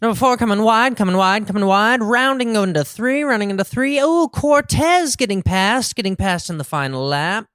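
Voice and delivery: high, loud excited race call; race announcer. Pretend. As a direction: call the horses rounding the turn. Joins doing race announcer.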